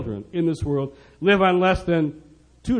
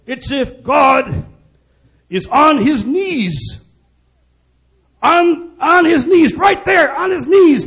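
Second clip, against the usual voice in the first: high, drawn-out, impassioned Irish brogue; 9 semitones higher, 5 dB louder, slower than usual. Retold or imitated. Irish rock star pleading with an elite Washington crowd, impassioned Irish brogue. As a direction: cry it out loudly in an imitated Irish brogue. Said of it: "in his wonderful Irish brogue".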